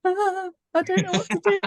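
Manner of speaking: flustered friend, imitated. Actor mimics a friend new to fan mail, mock flustered. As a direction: imitate an overwhelmed friend, laughing into it.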